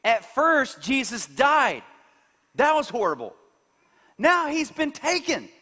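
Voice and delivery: high, strained distress as Mary; grieving. Acted. Mary alone at the empty tomb, grieving. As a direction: let Mary's panic climb into questions. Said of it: "She's grieving".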